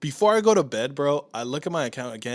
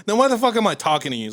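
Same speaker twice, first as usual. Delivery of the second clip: fast, higher, louder exasperation; angry outburst; 7 semitones higher, 3 dB louder, faster than usual. Retold or imitated. Yelling at the useless Amazon agent, fast and loud. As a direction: let the anger out. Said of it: "I was so mad".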